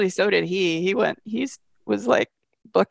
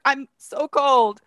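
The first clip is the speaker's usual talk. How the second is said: very slow, drawn-out cold moan; 7 semitones higher, 4 dB louder, much slower than usual. Retold or imitated. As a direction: stretch it out, shivering. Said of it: "And I'm just moaning".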